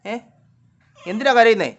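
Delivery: loud, high question to a pet; insistent repeat. Real, not acted. Repeats question after no answer.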